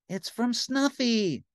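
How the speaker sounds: high, slow happy Big Bird; delighted high bird; happily. A narrator's reading. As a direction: glad childlike bird. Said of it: "said Big Bird happily".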